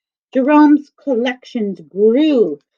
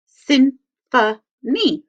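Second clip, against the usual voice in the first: Symphony stretched out slowly into its syllables; slow syllable count; 5 semitones higher, much slower than usual. Shown as a demonstration. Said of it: "So I'll show you how".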